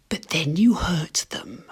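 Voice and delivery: slow, hushed, menacing whisper; slow unvoiced whisper, savouring the cruelty; sinister whisper. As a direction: breathe it out like a villain. Sinister aside about hurting characters.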